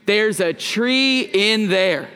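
slow, even "There's a tree in there" for the crowd to echo; slow and deliberate. Shown as a demonstration. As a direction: draw out each word of "There's a tree in there". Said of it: "can you say it with me? One, two, three".

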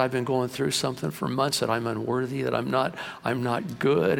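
ordinary talk

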